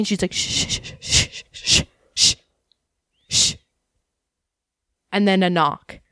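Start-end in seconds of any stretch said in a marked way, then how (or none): 0.3-3.5 hissed shh over and over; urgent shushing. Retold or imitated. Mom hiding from Jehovah's Witnesses, frantic shushing. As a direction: shush the kid hard so nobody hears.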